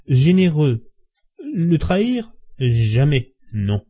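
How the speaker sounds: halting, tipsy protest with long breaks and slow, stretched phrases; stammering. A narrator's reading. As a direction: falter between phrases, pitch swinging up, struggling to stay loyal. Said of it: "dit-il en balbutiant". (balbutiant, lent et entrecoupé)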